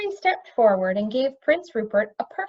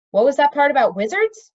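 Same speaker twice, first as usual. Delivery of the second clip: louder, higher demand; 5 semitones higher, 7 dB louder than usual. A narrator's reading. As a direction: demand an answer sharply. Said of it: "Mendinbar demanded".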